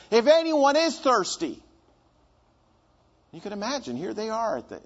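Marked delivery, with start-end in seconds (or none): 0.1-1.6 a slow, raised call across the temple; loud call. Acted. Jesus calling to the festival crowd, slow and raised. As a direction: call it out so the whole crowd hears. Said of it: "he says in a loud voice".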